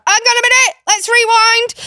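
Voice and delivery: very high, loud hammed-up interruption; playful mock alarm. Aunt interrupts a shouting child, very high playful call. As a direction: theatrically halt her shouting.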